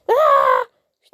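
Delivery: sudden loud yell of frustration; a sharp gamer's cry; frustrated shout. Real, not acted. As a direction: yell as your loot gets eaten. Gaming, snake eaten his loot.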